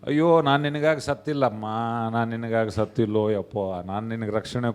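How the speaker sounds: low, moaning mock sorrow; mock lament. Jesus laments to an ungrateful believer, mock mournful. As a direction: wail wearily in a put-on low voice.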